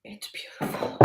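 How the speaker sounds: breathless whisper over the knife; awed whisper. Real, not acted. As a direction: whisper it in awe. Admiring knife in awe.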